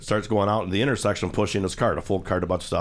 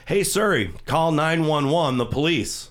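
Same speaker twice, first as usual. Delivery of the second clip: higher, slow, deliberate order to the phone; 6 semitones higher, slower than usual. Retold or imitated. Homeless man calls police on phone.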